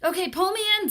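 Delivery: high loud plea; urgent ask. A narrator's reading. Paul asks Leslie to pull him up, high and loud. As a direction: call up urgently.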